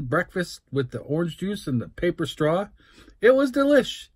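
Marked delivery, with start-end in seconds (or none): 3.2-4.1 high, bright delight; excited. Real, not acted. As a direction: gush about the food. Raving about the meal.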